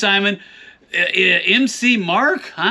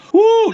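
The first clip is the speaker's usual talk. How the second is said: high whooping cheer; 13 semitones higher, 5 dB louder than usual. Acted. Cowboy cheer.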